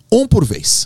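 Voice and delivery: put-on high, firm instruction to squabbling kids; retold teacher's order; calling to order. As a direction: raise your pitch to settle the kids.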